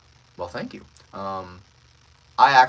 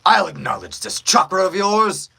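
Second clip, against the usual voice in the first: loud, higher-pitched villain line; excited villain; 9 semitones higher, 9 dB louder than usual. Shown as a demonstration. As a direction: declare it like a hyped-up villain. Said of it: "the pitch I was typically around was right here".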